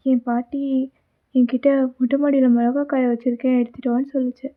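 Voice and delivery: loud, bright storyteller address to viewers; loud narration to the viewers; story narration to audience. Acted. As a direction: tell the audience the tale, loudly.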